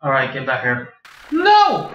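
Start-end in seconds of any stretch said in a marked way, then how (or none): 1.3-1.9 sharp high yelled no; sudden shout. Real, not acted. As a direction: yell it out in alarm. Frantic co-op gaming moment.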